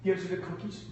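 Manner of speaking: ordinary talk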